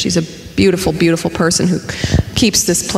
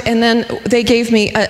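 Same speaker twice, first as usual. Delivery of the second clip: ordinary talk, nothing marked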